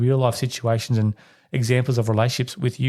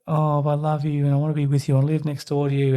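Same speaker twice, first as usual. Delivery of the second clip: a lightly raised, clingy doting mother; clingy film mum; 4 semitones higher than usual. Retold or imitated. Retelling a film, clingy mother dotes on her adult daughter, lightly raised. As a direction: coo it like a smothering mum.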